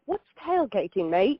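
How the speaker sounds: a light, mock Australian accent; imitating her Aussie friend in a light Australian accent. Aussie friend asking about tailgating, in a lightly mocked Australian accent. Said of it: "in my best accent".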